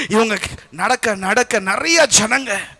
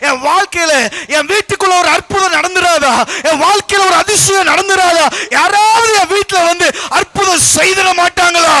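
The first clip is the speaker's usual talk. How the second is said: high, raised appeal; loud, pleading cry; 8 semitones higher, 6 dB louder than usual. Acted. Weary believer asking for a miracle at home, crying out. Voices people longing for a miracle.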